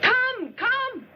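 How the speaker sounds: high, drawn call. Acted. Castaway calling toward a distant ship, high urgent call.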